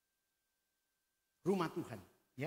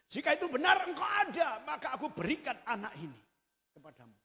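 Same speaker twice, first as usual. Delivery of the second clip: shouted vow; 9 dB louder, faster than usual. Acted. Hannah's vow re-enacted.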